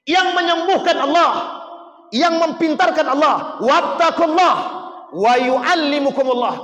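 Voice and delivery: high, loud pulpit proclamation; fiery preaching. Acted. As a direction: proclaim it to the whole mosque. Preacher raises voice on key point.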